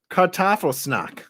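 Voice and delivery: high mock-German, falling at the end; slowed-down German accent; funny German accent. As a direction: read it out in comic German. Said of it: "the funniest accent ever".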